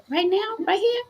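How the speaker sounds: high-pitched, rising mock disbelief; incredulous questioning. Girl at karaoke asked to pray, retold as incredulous. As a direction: imitate someone caught off guard, pitched right up. Acting out girl's startled questions.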